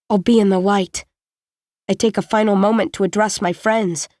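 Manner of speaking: plain reading voice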